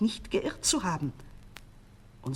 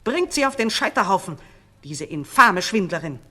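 louder, spiteful order; vengeful command; 8 dB louder than usual. A narrator's reading. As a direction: send her to the stake with venom. False queen demands the stake.

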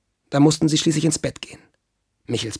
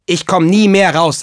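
put-on loud, higher voice for a stubborn boy, slightly hollow; dull, hollow voice; 5 semitones higher, 7 dB louder than usual. A narrator's reading. Boy shut in the shed refuses his mama, dull-voiced defiance. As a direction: refuse loudly as the sulking boy. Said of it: "sagte Michel mit dumpfer Stimme".

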